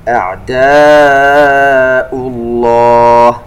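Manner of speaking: أعداء with the aa held four beats; slow chanted أعداء; long madd. Shown as a demonstration. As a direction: stretch أعداء. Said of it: "كلمة أعداء فيها مد متصل".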